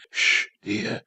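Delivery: whisper. A narrator's reading. Mother whispering to her son in the dark bed. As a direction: shush him under your breath. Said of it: "she whispered".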